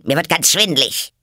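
high, loud, agitated complaint; worked up. Acted. Queen fed up with the bowing, worked up. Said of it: "ereiferte sich die Königin".

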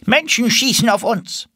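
high, urgent duck voice; duck leader. Acted. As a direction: report the danger in a high pitch. Duck leader after gunshot.

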